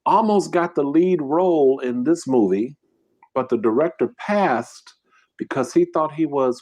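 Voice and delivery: ordinary talk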